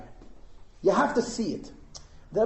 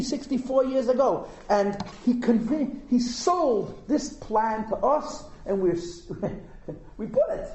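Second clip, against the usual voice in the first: usual speaking voice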